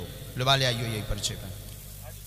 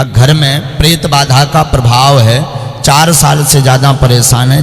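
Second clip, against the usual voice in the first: reading the slip aloud into the mic, much louder; loud proclamation; 18 dB louder than usual. Real, not acted. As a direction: godman reading out the written verdict, loud. Reads the parcha to the crowd.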